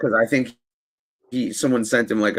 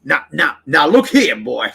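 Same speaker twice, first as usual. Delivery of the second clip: Foghorn Leghorn; 4 semitones higher, 4 dB louder than usual. Acted. Host answering friend, Foghorn Leghorn bluster. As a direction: bluster like the cartoon rooster. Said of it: "Foghorn Leghorn".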